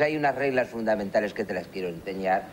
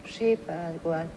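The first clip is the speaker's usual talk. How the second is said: put-on higher boyish Sí, padre; son answering; 7 semitones higher, much slower than usual. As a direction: play the obedient little boy. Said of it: "¿Estás anotando?".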